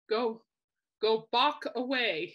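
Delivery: drawn-out slow "Go Bach away"; slow punchline. Retold or imitated. Quoting Mickey's pun to the viewers, slow and drawn out. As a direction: stretch the pun slowly, "Bach" landing. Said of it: "He goes".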